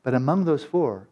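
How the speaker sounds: ordinary talk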